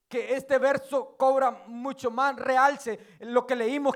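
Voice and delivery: usual speaking voice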